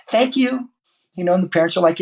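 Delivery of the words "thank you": much higher, mock little-girl thank you; girl in flashy tennis shoes thanking him, very high. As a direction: chirp it like a delighted eight-year-old. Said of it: "she goes".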